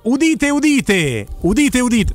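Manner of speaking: booming proclamation, drawn out; town crier. Pretend. Host announces returning guest.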